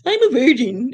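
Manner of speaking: high, loud mocking imitation of a commenter; mocking quote. Mocking a commenter who claims to be a virgin. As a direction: sneer out her words like a silly girl.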